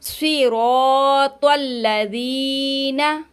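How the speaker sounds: slow, स्वी with a heavy swad, not a thin s. Shown as a demonstration. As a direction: round and thicken स्वी and त्वल. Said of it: "हमें इसको स्वाद पढ़ना है". (स्वी में स्वाद मोटा पढ़ना)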